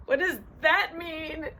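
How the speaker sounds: high, slow, strained question; stressed rabbit. Acted. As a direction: fret over the puzzle as a stressed rabbit. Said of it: "He's so stressed".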